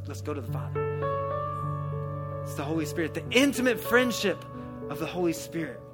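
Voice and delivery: ordinary delivery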